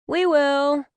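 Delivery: put-on high, loud kids' chorus; high-pitched, eager children answering as one; children in unison. A narrator's reading. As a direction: chirp it together like two obedient kids. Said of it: "Anya and Eric said in one voice".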